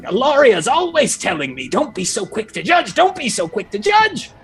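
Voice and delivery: high, exasperated self-scolding; scolding herself. Acted. Examiner hitting herself in the head, exasperated with herself.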